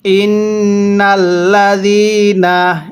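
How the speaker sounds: slow, drawn-out tajweed chanting; slow Quran recitation. Shown as a demonstration. Teacher demonstrating recitation to students, slow chant. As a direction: recite each syllable long and measured. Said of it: "হ্যাঁ, পড়ুন".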